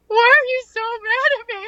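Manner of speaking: high, loud, fast tearful plea; hurt crying plea. Retold or imitated. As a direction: voice him near tears and wounded. Friend voiced crying, hurt.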